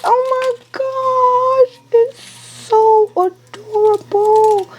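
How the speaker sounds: high, slow delighted squeal; high delighted gush. Real, not acted. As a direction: gush over something cute. Admiring finished tumbler.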